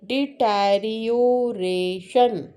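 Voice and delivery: stretched out syllable by syllable; clapping out each syllable; slow syllable demo. Shown as a demonstration. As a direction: say it slowly, beat by beat. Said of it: "say the word and clap your hands".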